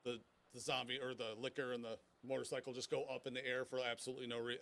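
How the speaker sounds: usual speaking voice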